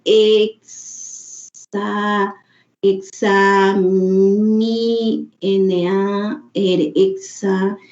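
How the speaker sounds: sounds out exa- and exami- piece by piece, very slow; slow halting decoding. Shown as a demonstration. Teacher imitating a slow young reader stumbling over a word. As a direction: spell out N A R letter by letter like a struggling reader. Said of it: "Si el niño está leyendo lento".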